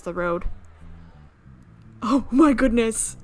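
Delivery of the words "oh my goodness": loud, slow drawn-out gasp; real panic as the chase starts; excited exclaim. Real, not acted. Racing after speeding car.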